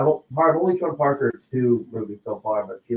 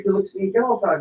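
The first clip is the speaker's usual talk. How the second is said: usual speaking voice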